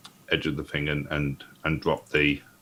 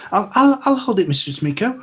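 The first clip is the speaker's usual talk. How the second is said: much higher, louder stammering eagerness; eager offer; 4 dB louder than usual. Acted. Gully rushes to grab the staff, high and eager. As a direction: jump in high and keen to grab it. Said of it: "she'll go".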